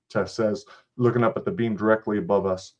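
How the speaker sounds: usual speaking voice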